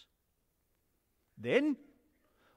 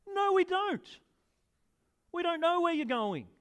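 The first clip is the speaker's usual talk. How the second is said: higher, insistent objection; Thomas protesting; 9 semitones higher than usual. Acted. Thomas to Jesus, high and bewildered. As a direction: push back, bewildered.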